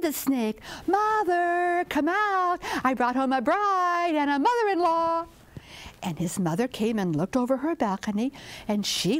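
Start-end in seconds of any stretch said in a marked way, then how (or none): 0.9-5.3 calling out. Acted. Snake announces his bride to his mother, high calling. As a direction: sing it out toward the house.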